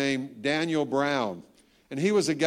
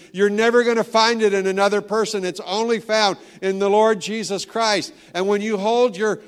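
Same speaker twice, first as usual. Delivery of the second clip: ordinary delivery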